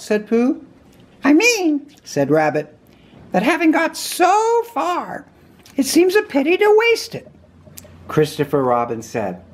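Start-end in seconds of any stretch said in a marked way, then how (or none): 1.2-1.9 very high character voice; Rabbit. A narrator's reading. Rabbit answering his guest Pooh, very high voice. 3.3-7.3 very high character voice; Rabbit. A narrator's reading. Rabbit answering his guest Pooh, very high voice.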